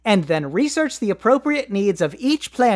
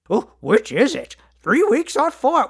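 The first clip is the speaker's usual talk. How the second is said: whiny letter writer; 4 semitones higher than usual. Retold or imitated. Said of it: "I can already hear the letters being drafted".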